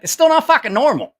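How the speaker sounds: loud, high-pitched exasperation; exasperated retort. Retold or imitated. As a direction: push back hard, voice jumping up. Retorts to those saying it's better.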